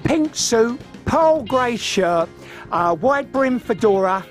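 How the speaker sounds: ordinary talk, nothing marked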